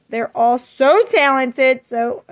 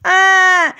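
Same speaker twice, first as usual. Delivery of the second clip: loud, higher-pitched exasperated "ah"; frustrated groan; 5 semitones higher, 6 dB louder than usual. Real, not acted. Vlogger fed up with her full phone, frustrated groan.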